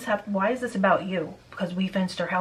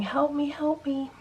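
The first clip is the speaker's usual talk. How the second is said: high, drawn-out mock scream at ordinary volume; slow, high-pitched imitation of pleading; imitated cry for help; 7 semitones higher, slower than usual. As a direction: retell a scream for help without shouting. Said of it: "someone screaming".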